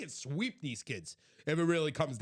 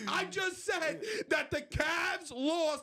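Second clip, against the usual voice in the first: incredulous shouting; 12 semitones higher than usual. Real, not acted. Co-host argues the Knicks win is crazy, incredulous shout. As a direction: holler it out in outraged disbelief.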